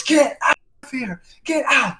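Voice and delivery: high-pitched retold cry; imitated high cry, fading in volume; alarmed woman. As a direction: order intruders out in a high voice. Retold startled woman ordering them out.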